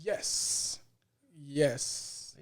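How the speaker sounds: usual speaking voice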